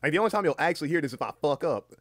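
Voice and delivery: loud, high-pitched Southern twang; actual southern voice. Real, not acted. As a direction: let the real Southern twang burst out. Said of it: "that last bit was my actual southern voice".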